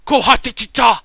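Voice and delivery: guard shouts back over his shoulder to the village; shouted call. Acted. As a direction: throw "Kohante Ticha!" back toward the village at full voice. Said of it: "And he's just like".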